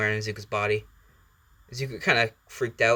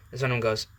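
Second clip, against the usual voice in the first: ordinary delivery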